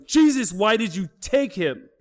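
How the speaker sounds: high, pained cry to God; retold anguished scream. Mother crying out over her dead son, high anguished cry. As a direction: wail it up high like a grieving mother. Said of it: "Mom is screaming".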